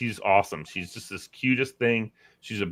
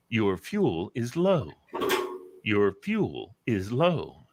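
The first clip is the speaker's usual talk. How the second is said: slow, evenly spaced car warning; imitated talking car; much slower than usual. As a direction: drag it out like an automated alert. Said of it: "all of a sudden I hear".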